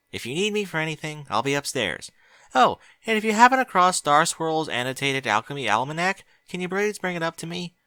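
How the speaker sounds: a hint of an eager young mare. Twilight voice.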